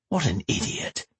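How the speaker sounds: soft muttered thought; inner thought. A narrator's reading. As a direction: say it to yourself, quietly. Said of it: "thought Matt".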